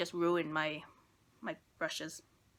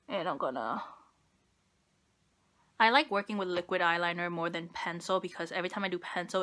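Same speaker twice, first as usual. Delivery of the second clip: ordinary talk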